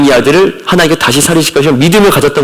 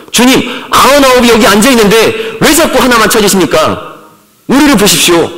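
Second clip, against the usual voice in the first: mock complaining church; 8 semitones higher than usual. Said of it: "교회들이 그렇게 얘기하는 거죠".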